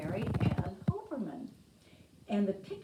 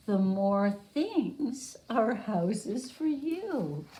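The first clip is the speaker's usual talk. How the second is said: put-on reading to children; 7 dB louder than usual. Teacher reads picture book aloud.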